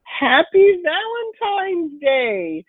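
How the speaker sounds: high, slow sing-song greeting; drawn-out happy greeting. Shown as a demonstration. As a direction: stretch it out high and bright. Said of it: "One of the main things that I wanna say".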